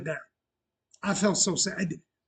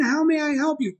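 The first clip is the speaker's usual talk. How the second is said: higher and bright-eyed eager; over-the-top cheerful service line; gushing imagined take; 7 semitones higher than usual. Retold or imitated. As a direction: beam the question like a thrilled host. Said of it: "here's how it played in my head".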